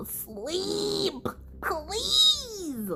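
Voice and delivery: high, drawn-out scoff; scoffing disbelief. Real, not acted. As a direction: sneer it up high, not buying it. Scoffing at a character's claim.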